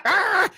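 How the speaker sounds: high imitated dog attack noise; imitated dog snarl. Dog attacking a fence, imitated snarl. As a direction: mimic a dog lunging at wood. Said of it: "and the dog goes".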